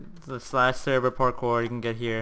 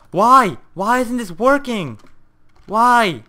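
high, loud pleading cries of why; despairing yell; 8 semitones higher, 8 dB louder, much slower than usual. Real, not acted. Near-crying gamer yelling at the broken parkour, despairing. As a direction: throw the question at the game in despair. Repeated failures, near tears.

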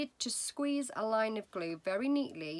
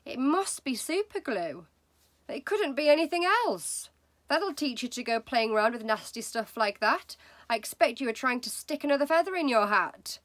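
louder, higher nagging wife; wife's voice; 4 semitones higher, 6 dB louder than usual. Acted. Wife lecturing husband about super glue, loud nagging. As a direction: scold him like a know-it-all, louder. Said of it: "Missus Wormwood said to him".

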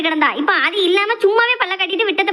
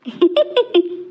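shy giggle. Acted. Daydreaming girl giggles alone.

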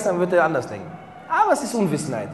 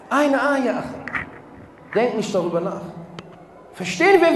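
loud, higher, insistent sermon delivery; full-force preaching; 7 semitones higher, 6 dB louder than usual. Acted. Preacher warning the brothers and sisters, at full force. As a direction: hammer it home to the congregation.

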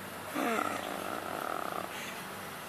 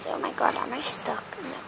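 ordinary talk, nothing marked

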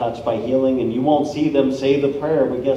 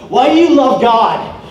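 put-on high, loud, slow drunken question; a hammed-up party girl calling out; 8 semitones higher, 7 dB louder than usual. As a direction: play the tipsy girl asking point-blank. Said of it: "This girl's all drunk".

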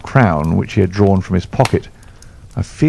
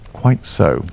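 ordinary delivery, nothing marked

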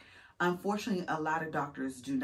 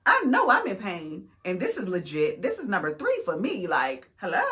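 exasperated self; 3 semitones higher, 7 dB louder than usual. Retold or imitated. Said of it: "And I'm like".

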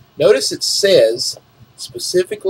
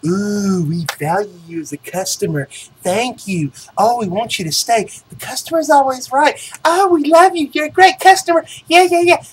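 syrupy, climbing ever higher and louder; mock gushing company voice; 7 semitones higher, 4 dB louder than usual. Mocking the company's flattery of customers, gushing rising parody. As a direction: parody a fawning corporation, building up. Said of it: "all the ooey gooey".

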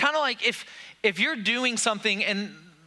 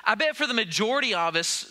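ordinary talk, nothing marked

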